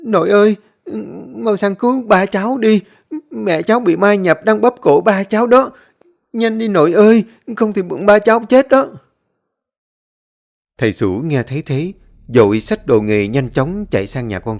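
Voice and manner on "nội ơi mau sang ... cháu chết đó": put-on high, loud child wailing for grandpa; a frightened boy begging for help; high child's tearful loud pleading; crying child calling. A narrator's reading. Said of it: "kêu khóc ôm sòm".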